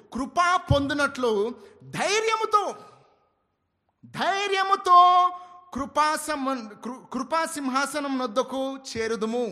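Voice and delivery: fast, loud, high reading of the verse; ఉత్సాహంగా వచన పఠనం; fervent Telugu recitation. Acted. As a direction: burst into the Telugu verse with fire.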